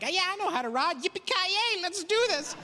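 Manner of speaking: high, whooping cowboy bravado; prairie cowboy. Acted. Prairie kid bragging to producers, cocky cowboy whoop. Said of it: "I'm a prairie kid, I wanted to represent".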